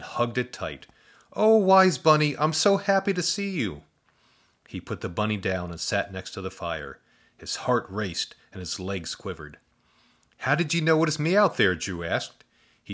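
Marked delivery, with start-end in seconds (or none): 1.3-3.8 high, loud happy cry; overjoyed. Acted. Boy hugs the bunny. 10.4-11.7 put-on higher, quick young boy. Boy asks the bunny.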